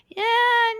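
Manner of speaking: a loud, high burst of yeah; imitating the housekeeper's loud, excited yeah; exclaimed yeah. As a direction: jump in eagerly to correct her. Housekeeper quoted, sudden exclamation.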